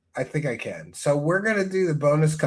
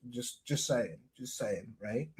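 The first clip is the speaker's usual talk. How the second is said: much softer, lower, mumbled under the breath; sheepish aside; 8 semitones lower, 14 dB quieter than usual. Real, not acted. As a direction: slip it in quietly, half-joking. Sheepish aside after the hype.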